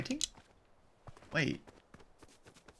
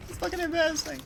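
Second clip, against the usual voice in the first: much higher, amused mockery; high laughing voice; faster than usual. Real, not acted. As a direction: say it still laughing.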